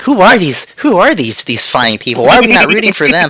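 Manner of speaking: high-pitched, wondering exclamation; amazed reaction. Retold or imitated. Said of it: "I was like".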